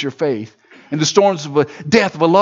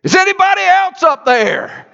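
high call for someone else; the man refusing God's answer; crying out; 3 dB louder than usual. Acted. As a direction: shout up the well in panic. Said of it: "The man cries out again".

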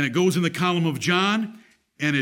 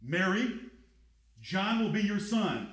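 softer, gentle and sincere; tender; 4 semitones higher, 7 dB quieter than usual. Shown as a demonstration. Preacher voices Jesus tenderly entrusting Mary to John. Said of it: "So it's".

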